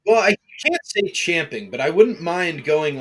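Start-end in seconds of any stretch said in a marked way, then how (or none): none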